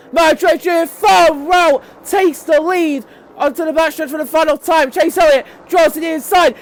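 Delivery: high, loud shouted play-by-play; thrilling finish call. Real, not acted. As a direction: build to a peak at the line. Calls the final lap.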